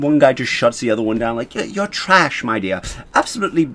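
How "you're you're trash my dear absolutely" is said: higher mock British accent, scornful. One viewer shutting the other down, mock British accent. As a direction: put on a cheeky British accent to dismiss. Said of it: "I can't do the damn, uh, what is it called? Accent".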